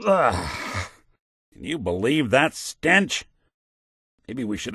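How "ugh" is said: disgusted ugh. Acted. Reacting to a foul stench.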